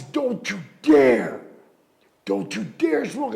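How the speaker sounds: slow, loud scolding; stern warning. Retold or imitated. Host loudly forbidding him to light up on air. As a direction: lay down the law, word by word.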